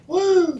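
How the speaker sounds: high drawn-out "woo"; whoop. Real, not acted. Co-host whoops over a wrestler's drinking, high whoop. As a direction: let out a high celebratory whoop. Cheering a man's beer drinking.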